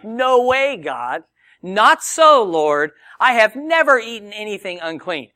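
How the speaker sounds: loud, high-pitched protest as Peter; indignant refusal. Acted. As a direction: refuse outright, voice shooting up in mock shock. Acting out Peter refusing God's command.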